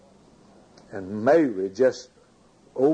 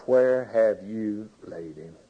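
slow, low, drawn-out question; heartfelt retelling of a troubled question; deep feeling; 3 semitones lower, slower than usual. Retold or imitated. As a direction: ask it slowly, weighed down with feeling. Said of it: "his feeling and his spirit when he said these words".

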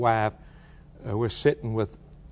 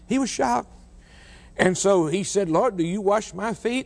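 shocked; 7 semitones higher than usual. Acted. Peter's shock retold.

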